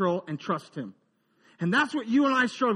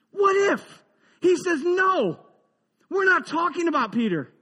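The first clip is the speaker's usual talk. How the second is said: preaching emphasis; 6 semitones higher than usual. Real, not acted. Preaching at full force.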